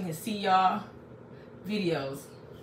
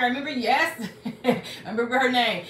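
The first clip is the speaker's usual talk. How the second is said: laughing; 3 semitones higher than usual. Real, not acted. Recognizing old viewer.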